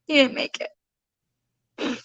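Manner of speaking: tearful. Real, not acted. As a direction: barely get the words out through tears. Retelling news of son's death.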